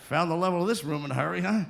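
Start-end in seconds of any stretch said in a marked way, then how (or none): none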